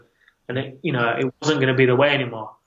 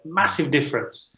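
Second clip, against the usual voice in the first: usual speaking voice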